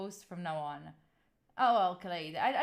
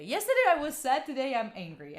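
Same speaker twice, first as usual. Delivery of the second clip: ordinary talk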